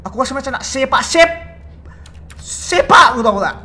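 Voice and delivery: loud rant at a useless teammate; frustrated yell. Real, not acted. Frustrated yelling at game partner.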